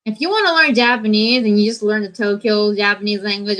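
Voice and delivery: usual speaking voice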